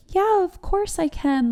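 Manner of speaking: high, slow and sweet, imitating the agent; light imitation of a kind phone agent; sweet reassurance. Imitating the calm Fidelity agent reassuring her, sweetly. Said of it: "she was so sweet about it".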